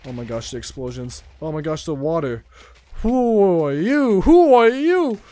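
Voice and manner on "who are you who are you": high loud who are you, drawn out; startled at a stranger; alarmed question. Real, not acted. Gamer startled by a player appearing amid explosions, alarmed.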